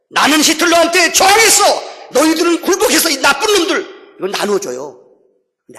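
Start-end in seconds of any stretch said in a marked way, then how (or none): 0.1-4.2 high, loud acted boast and denunciation; big talk, shouted. Ex-prisoner boasting of resisting Hitler, loud high denouncing shout. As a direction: shout down the ones who gave in. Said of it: "큰 소리 치기 좋아요".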